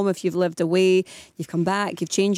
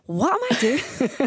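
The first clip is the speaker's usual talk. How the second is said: laughing disbelief; 6 semitones higher, slower than usual. Retold or imitated. Laughing at her old showreel.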